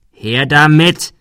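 loud, slowed-down command; bully repeats his demand slowly and menacingly; slow menacing order. Acted. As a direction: drag the order out, threatening.

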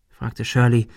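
usual speaking voice

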